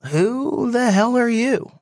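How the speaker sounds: high, drawn-out "who are you" challenge; retold incredulous demand. Team owner confronts unexpected visitor in his shop, incredulous. Retold startled greeting from Josh.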